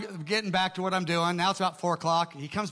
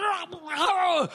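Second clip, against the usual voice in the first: demonic cry; 11 semitones higher than usual. Retold or imitated. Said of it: "he starts manifesting a demon".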